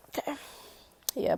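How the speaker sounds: usual speaking voice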